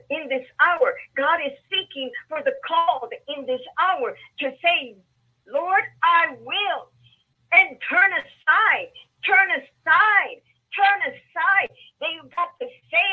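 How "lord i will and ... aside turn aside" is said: rising, slow, louder preacher cry; preaching build. Acted. Preacher urging the called congregation, rising slow cry. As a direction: build the call higher with each turn aside.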